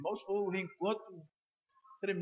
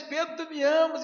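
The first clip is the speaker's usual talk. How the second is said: much higher and louder, pressing the question; insistent third asking; 9 semitones higher, 8 dB louder than usual. Acted. Jesus asking Peter the third time, loud and insistent. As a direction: call out to him, demanding an answer.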